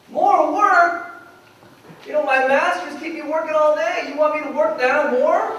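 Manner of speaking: loud, high and indignant, as if already worked to the bone; disbelieving complaint thrown back at God; incredulous protest. Acted.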